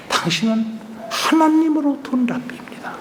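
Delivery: hushed, breathy confession of awe; secret whisper. Acted. Nicodemus secretly telling Jesus he is from God, whispered. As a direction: whisper it as if afraid to be overheard.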